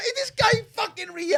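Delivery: high-pitched incredulous aside; acted, high-voiced exasperation; mock disbelief. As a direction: squeal it in comic disbelief. Acts out disbelief at toilet neighbour.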